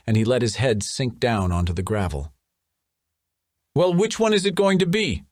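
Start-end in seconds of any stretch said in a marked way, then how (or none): 3.7-5.2 high, demanding snap; impatient anger. A narrator's reading. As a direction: press him for an answer now. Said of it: "Nasrin asked angrily".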